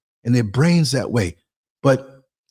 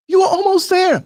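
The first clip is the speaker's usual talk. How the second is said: squeaky high mock protest; a high hammed-up partner's complaint. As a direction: play the disappointed partner, way up high. Partner's protest in his skit.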